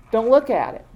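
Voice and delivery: usual speaking voice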